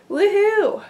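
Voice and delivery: high, loud little whoop of joy; excited cheer. Real, not acted. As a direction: cheer for your own progress. Celebrating progress.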